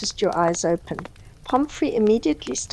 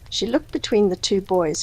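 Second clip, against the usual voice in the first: usual speaking voice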